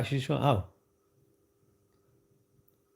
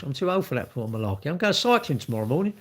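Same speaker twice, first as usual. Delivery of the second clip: normal talking voice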